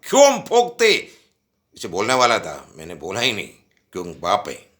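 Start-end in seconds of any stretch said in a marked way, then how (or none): 0.0-1.1 higher, louder angry retort. Retold or imitated. Son snapping back at his father in anger. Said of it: "मेरे को इतना गुस्सा आया".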